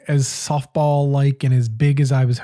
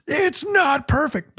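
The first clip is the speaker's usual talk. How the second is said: strained complaint; 11 semitones higher, slower than usual. Retold or imitated. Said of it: "'Cause I'm like".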